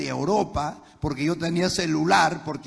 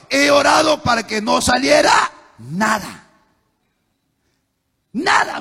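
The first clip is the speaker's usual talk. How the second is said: much higher and louder, hurling her words back; outraged shout; 8 semitones higher, 10 dB louder than usual. Retold or imitated. Husband shouts the wife's confession back, outraged.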